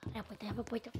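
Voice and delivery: very soft, tense low voice; hushed. Real, not acted. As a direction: keep it down so the monster won't hear. Hiding from the monster.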